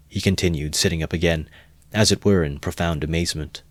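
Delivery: usual speaking voice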